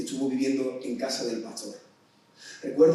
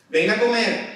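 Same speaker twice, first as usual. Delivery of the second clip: higher, louder mother's yell; mother calling loudly; 7 semitones higher, 7 dB louder, slower than usual. Shown as a demonstration. Preacher voicing a mother calling her son, loud call. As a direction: shout it like a mum calling down the hall. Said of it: "qué tiene que hacer un hijo".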